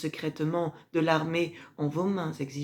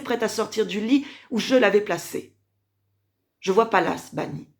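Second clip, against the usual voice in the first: building to a high, loud theatrical reproach; indignant accusation; 6 semitones higher, 6 dB louder than usual. Acted. Mother accuses her son over Junie and Octavie, indignant and loud. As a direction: confront him, voice rising and pressing.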